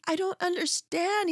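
put-on hurt child trying to make sense of it; son's voice. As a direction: ask it like the puzzled boy. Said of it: "he's like".

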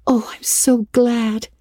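high, whispered joy; breathless gladness. A narrator's reading. Anne tells Miss Stacy she is glad, whispered high. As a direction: breathe it out with shining eyes. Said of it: "with shining eyes".

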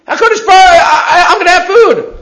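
high, loud imitated child; acted childlike certainty; trusting child. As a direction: exclaim it with a child's total confidence.

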